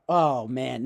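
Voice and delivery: ordinary talk, nothing marked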